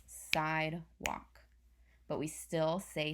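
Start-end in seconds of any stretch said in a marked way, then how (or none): 0.0-1.4 "sidewalk" stretched into "side" and "walk"; slow syllables. Shown as a demonstration. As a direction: say "sidewalk" very slowly in two parts. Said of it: "a word with two or more syllables".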